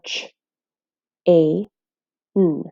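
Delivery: "ch, a, n" segmented slowly for learners, each sound alone; sounding out phonemes. Shown as a demonstration. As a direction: say "ch, a, n" as three separate sounds, pausing between. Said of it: "The sounds in the word are".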